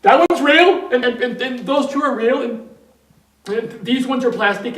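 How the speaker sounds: high, very loud, stammering urgency; acted frantic insistence; frantic patient. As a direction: shout it as the panicked patient. Panicked patient repeats his sorting.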